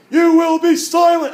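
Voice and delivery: booming high, drawn-out military command; stern yell. Retold or imitated. Soldier ordering chatty visitors at the tomb, stern yell. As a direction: bellow it slowly like a stern sentry. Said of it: "in a stern voice yells".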